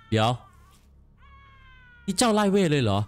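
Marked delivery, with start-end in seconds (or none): 2.1-3.0 high startled question; surprised. Real, not acted. Gamer reacting to the spell-chasing creature, startled high question. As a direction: blurt it out in disbelief.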